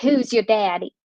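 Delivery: imitated backwoods Arkansas accent; mock a thick small-town Arkansas accent; lightly imitated Arkansas country accent; worst accent ever. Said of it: "in the worst accent you have ever heard".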